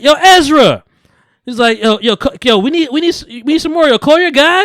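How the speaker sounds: jittery coked-out friend pushing hard; hyped begging. Retold or imitated. Friend begging Ezra to call the dealer, high and wired.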